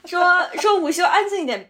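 a firmer second telling-off. Retold or imitated. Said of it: "老师说".

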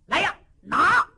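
loud, high command; commanding order. Acted. Yuwen Chengdu ordering his soldiers to seize the intruder. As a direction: snap the order to seize him.

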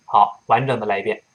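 normal talking voice

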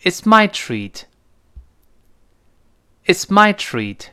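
slow, clear "It's my treat" with the stress on "my"; read aloud. Shown as a demonstration. Teacher asks for "It's my treat" read aloud. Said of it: "大声读一遍".

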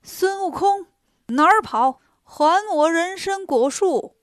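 immortal's loud, angry demand; shouting. Acted. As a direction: call out after fleeing thieves, drawing out the last demand. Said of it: "大仙喊".

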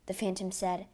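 ordinary delivery

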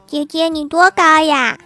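put-on slow, high childlike question; curious little girl. Child quizzes girl on the bus, childishly curious. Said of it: "郭晓霞问".

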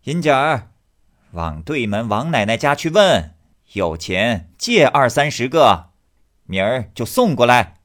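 put-on loud call across the street to the girl. Wife calls loudly to her daughter Yin-jie to go borrow money. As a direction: raise the voice to send the daughter next door. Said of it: "他娘子便叫女孩儿".

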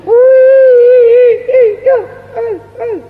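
long, high stylised opera sobbing; qingyi opera cry. Shown as a demonstration. Showing how the qingyi role weeps, stylised high cry. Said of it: "如果要是青衣哭".

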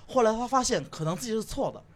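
ordinary talk

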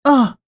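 sharp high cry of fright; startled scream. Acted. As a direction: jump and shriek in shock. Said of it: "吓了一大跳".

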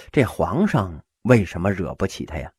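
ordinary delivery

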